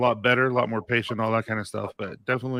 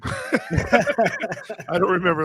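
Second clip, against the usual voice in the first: said through a light laugh; chuckling at his own tiredness; 8 semitones higher, much slower than usual. Real, not acted. Admitting he is always tired.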